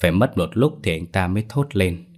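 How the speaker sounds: plain reading voice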